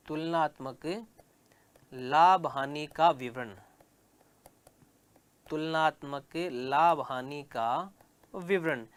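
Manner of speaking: slow, spaced dictation pace; dictating word by word to the class. Shown as a demonstration. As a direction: say it slowly so students can write it down. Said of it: "teacher dictates heading for notes".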